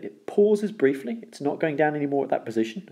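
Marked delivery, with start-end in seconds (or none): 0.0-1.2 slow, stretched and higher, as if pausing; slowed right down for emphasis; drawn out slowly. Shown as a demonstration. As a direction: stretch the words out to act out the pause. Describing the curve pausing.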